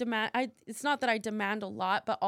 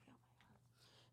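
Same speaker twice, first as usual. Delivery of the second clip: whispered disbelief; 38 dB quieter, much slower than usual. Retold or imitated. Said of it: "I'm just like".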